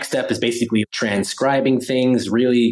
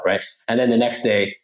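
usual speaking voice

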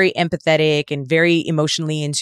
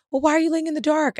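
small son; 10 semitones higher, faster than usual. Retold or imitated. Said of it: "I was like".

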